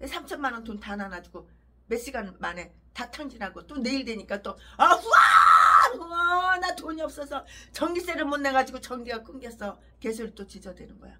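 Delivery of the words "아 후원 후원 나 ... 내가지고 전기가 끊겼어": mock begging. Mocking pastor begging donations.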